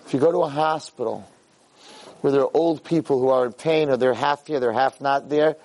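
normal talking voice

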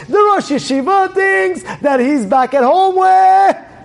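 high, loud and stretched out; animated shout. Retold or imitated. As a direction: tell it loudly, drawing it out slowly. Dramatic retelling of mix-up.

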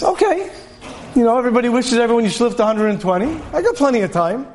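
high, breezy brush-off of learning; lazy excuse voice. Acted. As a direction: shrug it off like there's all the time in the world.

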